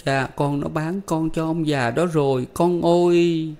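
mother telling her son he was sold, crying; crying while speaking. A narrator's reading. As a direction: tell him through tears. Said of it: "vừa khóc vừa nói rằng".

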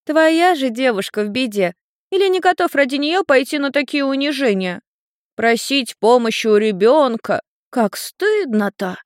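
high, mocking child voice; taunting little girl. A narrator's reading. Little girl shames Ian over his girlfriend, high-pitched taunting. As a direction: tease in a high voice, slowing to draw out the shame.

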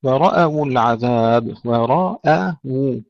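ورأوا with a full heavy ra then a light hamza. Shown as a demonstration. Teacher slowly showing students how to say ورأوا. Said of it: "تفخيم الراء ثم ترقيق الهمزة".

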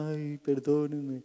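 slow, drawn-out pretend weeping; mock weeping apology. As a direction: drag out a teary sorry. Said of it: "no solo llora".

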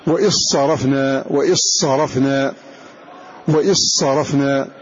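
وإذ صرفنا said "wa-issarafna", with a doubled ص; dh merged into s. Shown as a demonstration. Teacher drilling students: وإذ صرفنا as "wa-issarafna". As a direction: drop the ذ into an emphatic ص. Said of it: "ننطق بالذال مدغمة في الصاد".

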